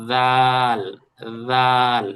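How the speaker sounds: দাল with tongue between teeth, voiced th; Arabic dhal. Shown as a demonstration. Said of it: "জিহ্বাটা বের হবে হালকা". (জিভ বের করে দাল)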